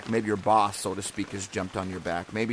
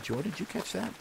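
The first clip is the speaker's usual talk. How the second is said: quieter and much higher; soft excited aside; 10 semitones higher, 7 dB quieter than usual. Retold or imitated. Counselor to Jordan after she laughed, soft high aside. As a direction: retell a hushed, high-pitched nudge to a child. Retelling gentle word to camper.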